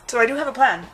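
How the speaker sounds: speaking through a burp; burping the words. Real, not acted. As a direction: burp while talking. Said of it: "I'm burping a lot 'cause I just had something to drink".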